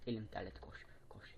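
whispered under his breath. Real, not acted. Telling viewers his hand sweats, in a whisper. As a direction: breathe the words out voicelessly.

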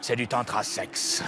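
very low, soft seductive purr; sultry low seducer. Acted. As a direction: play the smooth tantra seducer. Seducer answers teammate.

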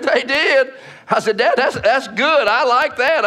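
high, amused reaction; retold delighted surprise. Son hearing dad's church had a great offering, delighted surprise. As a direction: play up the pleased surprise.

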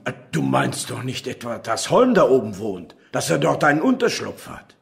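alarmed disbelief building to urgency; rising alarm. Acted. Alarmed detective hearing Holm's hideout.

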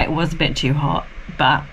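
normal talking voice

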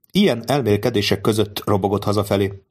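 ordinary delivery, nothing marked

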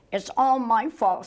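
mild high-pitched sob; a touch of tearful self-blame; sobbed. A narrator's reading. Cub blames herself for the snow, sobbing. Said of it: "sobbed Button".

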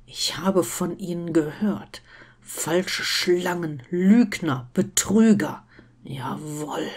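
slow, hissed contempt sinking into a whisper; venomous half-whispered accusation; hissing accusation. A narrator's reading. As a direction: hiss each insult, ending in a whisper. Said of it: "zischte da der äußerst blasse Mann".

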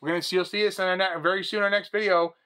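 high, loud show-host sign-off; hyped host outro. Acted. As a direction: wrap it up like a TV host. Hosts outro like announcer.

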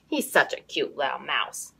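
plain reading voice

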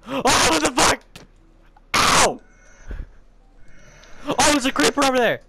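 high, loud startled cries; startled yelling. Real, not acted. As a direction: jump at every scare. Sudden scares in game.

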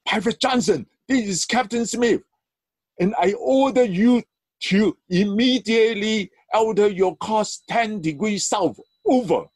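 high shout over the radio. Acted. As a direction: shout the order, high and hard. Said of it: "the captain shouted back over the radio".

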